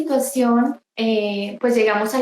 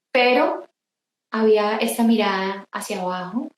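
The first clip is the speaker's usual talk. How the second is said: usual speaking voice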